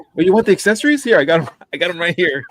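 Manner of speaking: loud high hawker pitch; pushy vendor. Retold or imitated. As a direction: hawk it like a street vendor. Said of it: "I'll just show up ... and be like".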